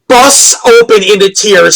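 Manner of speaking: ordinary talk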